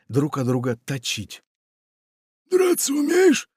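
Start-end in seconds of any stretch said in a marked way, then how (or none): 2.5-3.5 very high, louder ominous question; threatening pirate. A narrator's reading. Pirate asks Funtik ominously if he can fight. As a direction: ask like a storm is coming. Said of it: "голосом, предвещающим грозу".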